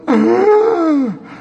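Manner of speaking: high, drawn-out mock gasp; acted cry of horror; shocked gasp. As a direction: gasp like horrified onlookers. Imitating the people in the room.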